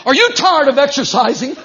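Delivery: rally them to the altar; altar call preaching. Acted. Preacher calling overweight people to the altar, fired up.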